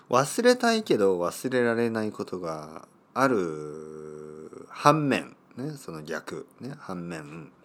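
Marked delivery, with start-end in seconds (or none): 0.1-4.7 slow. Real, not acted. Slow reflective line.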